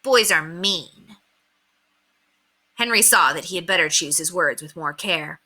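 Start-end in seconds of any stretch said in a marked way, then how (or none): 0.0-1.2 put-on slow, matter-of-fact child; informing. A narrator's reading. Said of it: "Ramona informed him".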